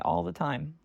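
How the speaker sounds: ordinary talk, nothing marked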